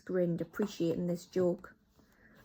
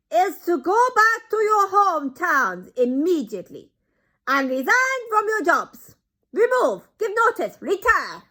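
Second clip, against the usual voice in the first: put-on much higher, loud accented orders; commanding Grand High Witch; 11 semitones higher, 12 dB louder than usual. Grand High Witch orders them to quit their jobs, loud accented. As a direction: bark instructions.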